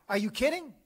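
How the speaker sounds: high, loud mock disbelief; incredulous retort. Saint answering the curious guy, high loud mock disbelief. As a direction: toss it back like it's obvious. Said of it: "I'm adding a little bit of flavor".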